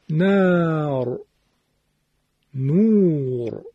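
slowly drawn-out نار، نور with thick final R; long vowel, heavy R. Shown as a demonstration. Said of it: "فتحه کشیده". (نار، نور کشیده با رای درشت)

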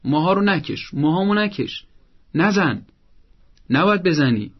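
decisive telling-off without shouting; firm and decisive. Shown as a demonstration. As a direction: correct the child in short, separate commands. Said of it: "با لحنی قاطع و محکم".

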